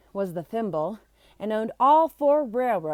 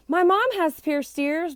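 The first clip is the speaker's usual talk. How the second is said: said loudly; 8 semitones higher than usual. A narrator's reading. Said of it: "Barry said loudly".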